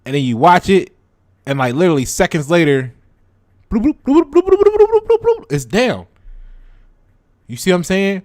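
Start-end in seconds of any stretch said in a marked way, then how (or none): 3.7-5.5 rapid, high-pitched "bloop" run; imitated tumbling noise. Mimics price collapsing seconds later.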